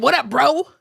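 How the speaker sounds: a woman greeting a man as if he were one of the guys; mock bro greeting. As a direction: toss it off like a dude hailing a friend. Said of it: "a woman that's saying".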